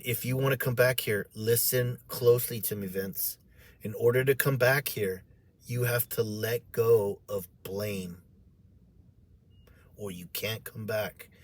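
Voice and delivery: low, soft, grave instruction; quiet, measured voice of a guiding angel; gentle angel counsel. Retold or imitated. Angel gently instructing the crying teller.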